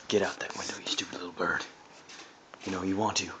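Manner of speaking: hissed whisper. Real, not acted. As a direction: whisper it urgently to the bird. Urging bird at the window.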